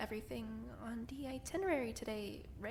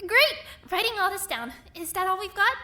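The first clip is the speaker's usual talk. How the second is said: bright, loud, eager host; pumped to get everyone's ideas; eager and upbeat; 5 semitones higher, 10 dB louder than usual. Acted. Host cheering on the group's suggestions, loud and eager.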